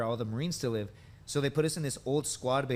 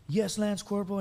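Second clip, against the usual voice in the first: eager junior; 8 semitones higher than usual. Retold or imitated. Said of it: "I was just like".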